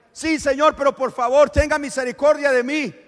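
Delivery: loud, high pleading; begging for mercy. Acted. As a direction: beg him not to walk away. Woman keeps begging.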